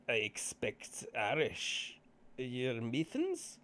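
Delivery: put-on halting foreign accent in broken English; hesitant stranger with a thick put-on accent. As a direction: feel for each word in a foreign accent. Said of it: "that was a accent fail".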